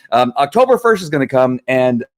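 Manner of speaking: ordinary talk, nothing marked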